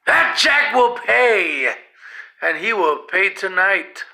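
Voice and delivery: loud, slow furious threat; angry yell. A narrator's reading. Rosemary yelling her anger over Jack. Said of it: "she yelled".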